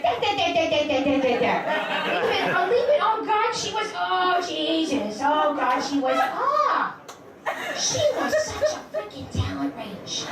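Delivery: dad lost in a [name] song in the car, high and gushing; dad gushing over Whitney. Retold or imitated. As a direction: ham up dad's swooning praise. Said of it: "He's like".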